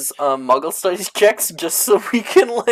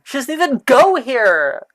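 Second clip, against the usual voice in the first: movie quote shout; 10 semitones higher, 5 dB louder than usual. Retold or imitated. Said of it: "That's Gemma if she knew".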